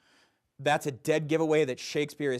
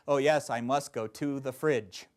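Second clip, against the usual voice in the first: slow, measured verse rhythm on an everyday line; mock iambic verse. Teacher mimics tired actor speaking in iambic rhythm. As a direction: say it like Shakespeare by accident. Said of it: "you'll find yourself going".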